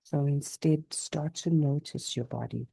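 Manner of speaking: unhurried yoga nidra voice with long pauses; guided meditation. Real, not acted. Teacher guiding listeners to notice body and breath, calm meditation. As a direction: guide them gently through the body, leaving space after each phrase.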